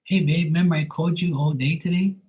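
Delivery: lightly voiced clingy girlfriend; mock sweet girlfriend. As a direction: play her all sugary and clingy. Mocking her evening call.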